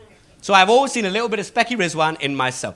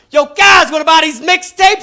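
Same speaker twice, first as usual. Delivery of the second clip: loud, high, pushy sales call; pushy hustler; 9 semitones higher, 10 dB louder than usual. Retold or imitated. Comedian playing the salesman shouting his pitch. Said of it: "He walks up like".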